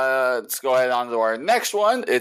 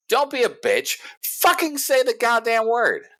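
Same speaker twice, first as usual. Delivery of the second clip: exasperated rant; 14 semitones higher than usual. Real, not acted. Ranting about people avoiding swears.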